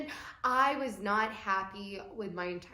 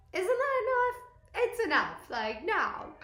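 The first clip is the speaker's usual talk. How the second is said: exasperated outcry; 12 semitones higher, 5 dB louder than usual. Real, not acted. Outburst about losses.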